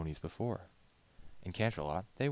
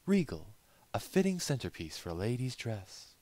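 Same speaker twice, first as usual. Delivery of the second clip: higher, elegant lady's appraisal; refined lady; 6 semitones higher, 3 dB louder than usual. Acted. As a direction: savour the words like a connoisseur. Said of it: "she said".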